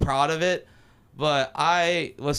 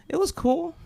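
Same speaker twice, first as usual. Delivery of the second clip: imitated higher, noncommittal reply with a rising end; noncommittal answer; 11 semitones higher than usual. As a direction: mimic her giving a neutral verdict. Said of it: "The most neutral answer".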